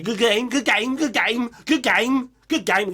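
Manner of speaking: mock catchphrase impression; rhythmic, repeated catchphrase; Bruce Forsyth. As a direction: chant it like a game-show catchphrase. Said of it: "Sounds like I'm mocking him".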